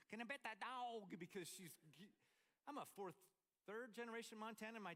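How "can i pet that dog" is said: granddaughter voice. Retold or imitated. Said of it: "I was like".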